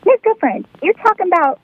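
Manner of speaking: put-on high, fast child voice with a Swedish-sounding lilt; Swedish-ish accent. First grader asking the teacher a cheeky question, high Swedish-tinged voice. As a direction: play a little girl with a vaguely Swedish accent. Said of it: "What accent are you doing?".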